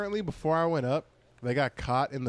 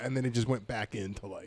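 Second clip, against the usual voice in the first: ordinary talk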